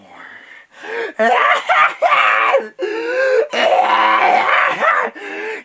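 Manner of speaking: long, very high shrieking laugh; screaming maniacal cackle; crazed laughter. Acted. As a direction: laugh wildly until it turns to wailing. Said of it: "Laughing more".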